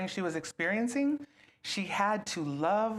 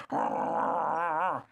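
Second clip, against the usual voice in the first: grumpy growl; 2 semitones lower than usual. Retold or imitated. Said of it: "he's gonna go".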